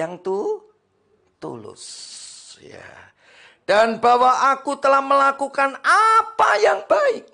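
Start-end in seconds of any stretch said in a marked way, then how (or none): 3.7-7.3 loud, high scripture reading; full-force preaching. Acted. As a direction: proclaim it to the congregation. (membaca ayat dengan suara khotbah lantang)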